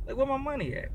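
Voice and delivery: a hint of a little cartoon debt collector; Stevie Griffin. Friend asking friend for owed money, light cartoon impression. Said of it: "in the little Stevie Griffin voice".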